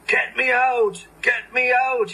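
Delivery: stuck engine calling out loud. A narrator's reading. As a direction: call for help from the ditch. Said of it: "he called".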